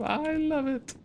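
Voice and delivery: squeaky delighted squeal; high excited squeal. Real, not acted. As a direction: squeal it with joy.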